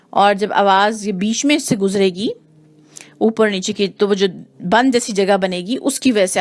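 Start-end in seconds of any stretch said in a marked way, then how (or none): none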